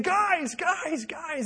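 high-pitched mock yell, not full volume; retold yelling. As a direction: imitate someone calling out urgently. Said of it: "yelling at the top of his lungs".

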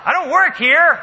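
high, loud comic outburst; indignant protest. Acted. Pastor mistaken for store staff, loud indignant protest. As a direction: blurt it out in mock outrage.

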